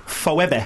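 "Phoebe" sounded out letter by letter as "Fo-ebb-eh"; mispronounced from spelling. Shown as a demonstration. Co-host teasing the host's reading, "Phoebe" mispronounced as spelt. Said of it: "which is spelt, like".

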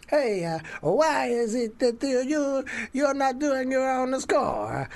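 hammed-up Italian maestro; mock Italian accent. Imitating [name] questioning the director, Italian accent. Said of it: "I imagine that's exactly how he talks".

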